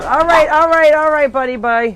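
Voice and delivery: loud, high-pitched placating goodbye; placating call. Real, not acted. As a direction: call out to appease an upset bird. Backs away from an upset bird.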